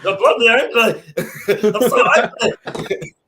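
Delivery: loud, high, laughing outburst; comically worked up, cracking up; laughing mock fury. Real, not acted. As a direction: shout it through your own laughter. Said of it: "I'm that angry I can't even speak".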